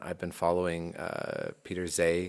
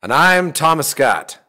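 loud, higher, drawn-out name announcement; show intro; 7 semitones higher, 8 dB louder, slower than usual. Acted. Co-host introduces himself after the host, big show intro. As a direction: announce yourself slowly and big.

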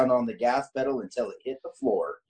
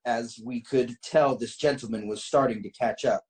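normal talking voice

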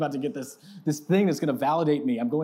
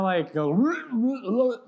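mock burp; 9 semitones higher, 5 dB louder than usual. Imitates a burp.

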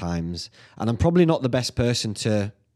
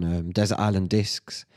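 usual speaking voice

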